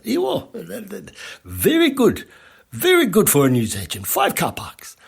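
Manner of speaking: put-on Indian accent. Newsagency owner proud of his parking, in an imitated Indian accent. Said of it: "Sorry about the accent".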